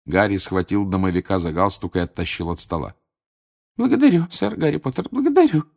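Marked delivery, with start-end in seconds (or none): 3.8-5.7 high elf voice with aspirated words; high, puffed and grateful; breathless Dobby. A narrator's reading. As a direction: gasp out thanks as a little elf. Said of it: "с придыханием проговорил Добби".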